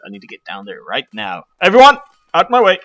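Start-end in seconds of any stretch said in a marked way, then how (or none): 1.6-2.8 loud, high, quick call; urgent shout. Pretend. Player rushing down through the crowd, urgent shout. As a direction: clear the crowd out of your path.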